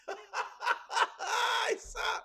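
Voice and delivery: high mock villain cackle; evil cackle. After spooky Auntie Riri warning.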